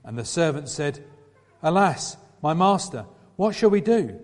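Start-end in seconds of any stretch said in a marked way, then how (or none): 1.6-4.2 higher, louder panicked plea; rising alarm, climbing on the question; frightened cry. A narrator's reading. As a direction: servant to Elisha, seeing the army, frightened. Servant sees army around city.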